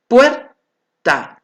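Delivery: "Puerta" split as puer, pause, ta, first part loud and high; syllable by syllable. Shown as a demonstration. Teacher syllabifying for the class, in separated syllables. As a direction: punch puer in "Puerta", then drop to a separate ta. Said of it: "cuando realizo el silabeo".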